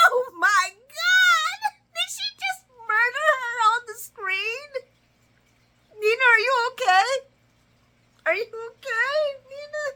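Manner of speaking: high-pitched, hammed-up squeal of disbelief; overplayed high shocked reaction; mock shock. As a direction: squeal as if you just witnessed a takedown. Mock shock at an on-screen put-down.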